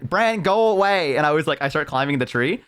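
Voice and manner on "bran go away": mock upset friend. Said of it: "And she's like".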